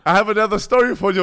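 mock German accent, loud; teasing German accent. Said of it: "mit deutschem Akzent".

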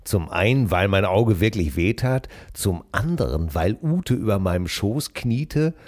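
low, hushed reading voice; story narration. Acted. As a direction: read it like a late-night audiobook. Continues reading novel aloud. (gedämpfte Vorlesestimme)

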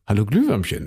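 low ghost greeting. Acted. Ghost greeting the firefly in a low creepy voice. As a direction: drop the voice for a creepy pickup line. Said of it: "meine Anmache wäre jetzt gewesen".

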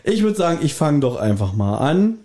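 ordinary delivery

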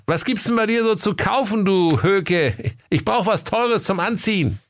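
very high, demanding comic voice; haughty rich customer. Acted. Kaiser demands expensive clothes from shopkeeper, high and haughty. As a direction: ask like a spoilt rich man.

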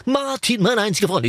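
high yelled crowd chant; crowd shouting. Retold or imitated. Fans at the gala chant, high mock shout. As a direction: call it out like a cheering crowd. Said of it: "dann schreien viertausend Menschen".

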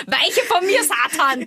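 high-pitched hammed-up banishing of the devil; mock exorcist. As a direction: play at casting out Satan.

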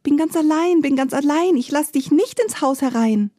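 high, bright little piglet voice, lifted well above the narration; a tiny pig chanting from behind the door; kleines Schweinchen. A narrator's reading. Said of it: "Das zweite Schweinchen aber antwortet". (hohe Ferkelstimme, singend)

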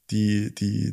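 normal talking voice

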